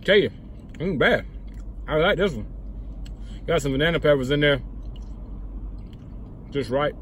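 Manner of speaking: talking between bites; mouth full, chewing. Real, not acted. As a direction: food in mouth. Seen 0: Bites pizza pocket at -3s; jaw working, lips pursed while talking after.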